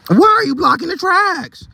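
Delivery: high, strained shouted question; angry yell. Acted. Engineer scolds the bus driver, high angry yell. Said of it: "yelled the train engineer".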